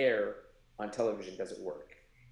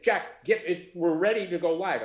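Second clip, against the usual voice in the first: urgent call; 7 semitones higher, 8 dB louder than usual. Retold or imitated. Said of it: "And they said".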